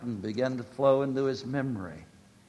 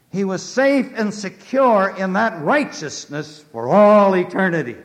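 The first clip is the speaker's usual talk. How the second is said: high, loud declaration; full-force pulpit cry; triumphant preaching; 6 semitones higher, 10 dB louder than usual. Acted. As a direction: shout the assurance home. Preaching climax.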